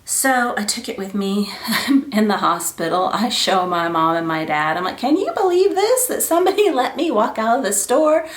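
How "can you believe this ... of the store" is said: amazed. Retold or imitated. Showing parents the bracelet.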